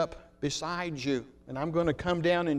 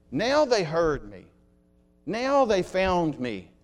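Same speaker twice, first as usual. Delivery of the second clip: excited cry, louder, drawn out at the end; excited castaway; 5 dB louder than usual. Acted. Stranded man seeing his rescuers arrive, excited. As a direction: call it out, overjoyed at being found. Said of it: "he was so excited he could just not stand himself".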